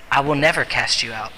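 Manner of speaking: understated, steady promise; calm reassurance. Acted. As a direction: drop low and reassure gently. Jesus answering the doubter's protest.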